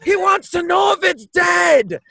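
high, insistent holler; yelling out. Acted. Player relaying a question to Clive, yelling out. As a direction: call out as if across the water. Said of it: "I'm yelling out".

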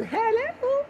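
high, affected singsong hello; movie-star greeting. Acted. Self-styled film star greeting fans, hammed-up coyness. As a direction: coo it like a pretend celebrity.

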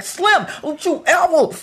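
high, slow stern warning; scolding warning. Retold or imitated. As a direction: drag it out like a scolding. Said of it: "I'm like".

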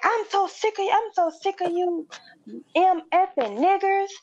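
high mock tirade. Customer ranting at the cashier, mock angry tirade. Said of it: "he snapped".

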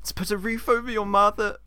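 high-pitched nagging stage mother; aggrieved mum, hammed up; scolding pantomime dame. Plays scolding Mother Jamalio.

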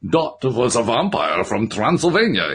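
slow, mock-thick Transylvanian accent on "Dot was a vampire". Shimoda teases the narrator in a mock-thick Transylvanian accent. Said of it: "he said in words thicker than the creature's own".

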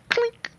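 very high squeaky clink; glass clink sound. Retold or imitated. Imitating a toast with glasses of liquor, high clink.

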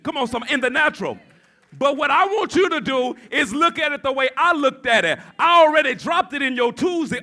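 forceful pulpit delivery with shouted peaks; impassioned preaching. Real, not acted. Preacher telling congregation to rejoice early, impassioned preaching. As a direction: press the promise on the congregation, loudly.

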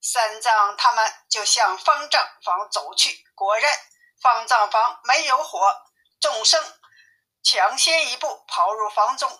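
plain reading voice

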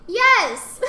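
emphatic yes. Retold or imitated. Answering the interviewer emphatically.

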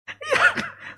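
a sudden high laugh at his own joke; high burst of laughter. Real, not acted. Preacher laughing at his own joke about big families, high burst of laughter.

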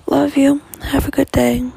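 loud sign-off. Real, not acted. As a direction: call out a big loving farewell. Cheerful sign-off to viewers.

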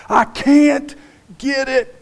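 a lifter pinned under the bar, straining. Retold or imitated. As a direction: squeeze it out like the bar is crushing you.

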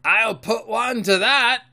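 loud, high, slow pirate shout; old seaman's cry. A narrator's reading. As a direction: cry out as an angry old sailor. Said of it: "cried the old mahogany-faced seaman".

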